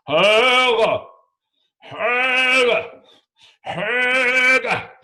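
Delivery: loud high bellowing call; troll mating call. Retold or imitated. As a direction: bellow the name across the lake. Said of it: "they go like".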